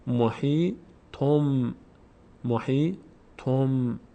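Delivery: محیطم drawn out slowly with a clear final m; slow careful demo. Shown as a demonstration. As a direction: spell out محیطم syllable by syllable.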